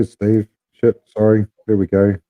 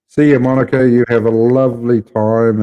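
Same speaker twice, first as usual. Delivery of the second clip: ordinary delivery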